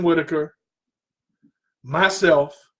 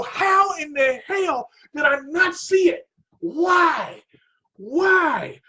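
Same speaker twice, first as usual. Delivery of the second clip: high, exasperated self-reproach; retold frustration; 8 semitones higher, slower than usual. Student kicking himself for missing the simple answer, frustrated. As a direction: cry out in exasperation at yourself. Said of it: "And I'm frustrated".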